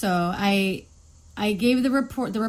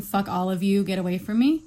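ordinary talk, nothing marked

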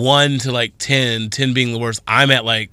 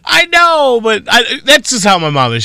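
high, loud defensive outburst easing down; worked-up, high-pitched defence of mom; exasperated protest; 10 semitones higher, 6 dB louder than usual. Real, not acted. Protesting co-host's urgent care remark.

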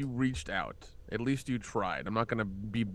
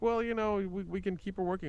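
higher-pitched, hedging parent; retold motherly justification, pitched up; lightly voiced mom; 8 semitones higher than usual. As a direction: imitate a mom making excuses. Voices mom's weak justification.

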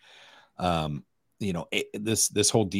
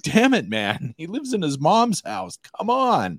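exasperated; 9 semitones higher, 4 dB louder than usual. Retold or imitated. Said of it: "I'm like".

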